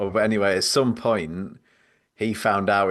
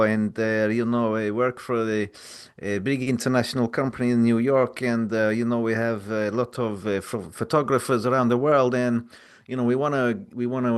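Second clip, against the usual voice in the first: higher, put-on foreign salesman voice; Marco voice; 3 semitones higher than usual. Prank caller selling a job to a photographer, in a put-on Marco voice. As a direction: pitch a fake job offer as an overeager recruiter. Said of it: "do the, the Marco voice".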